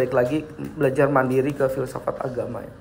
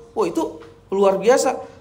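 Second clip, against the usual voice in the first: mock amazement; 5 semitones higher, slower than usual. Imitating amazed football fans.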